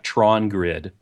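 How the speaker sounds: usual speaking voice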